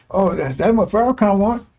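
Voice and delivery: ordinary delivery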